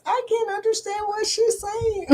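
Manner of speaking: mock grandmother's complaint; imitating grandma fussing; a hint of a fussing grandmother. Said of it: "She says".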